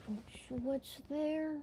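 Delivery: soft, high-pitched mimicking of a puzzled viewer; nitpicking viewer. Livestream viewer picking at tiny details, soft mock puzzlement. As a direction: imitate someone peering closely, high and soft.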